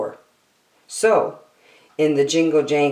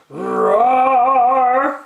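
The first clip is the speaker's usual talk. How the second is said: loud, high, hammed-up roar; bear roar; 10 semitones higher, 5 dB louder than usual. Bear sings into the microphone, loud playful roar.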